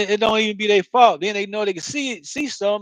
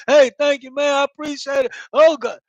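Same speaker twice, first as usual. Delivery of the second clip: higher, louder hey thank you; gushing thanks; 5 semitones higher, 5 dB louder than usual. Retold or imitated. Imitating himself thanking the couple again and again, gushing. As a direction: overdo the gratitude. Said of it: "keep telling them".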